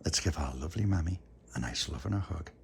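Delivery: soft, hushed goodnight voice; bedtime whisper. Real, not acted. Bedtime soft talk to child.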